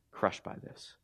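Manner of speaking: near-whisper, very soft; hushed repeat. Real, not acted. Preacher repeating Peter's heartbreak to the church, hushed. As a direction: let the line sink to a whisper.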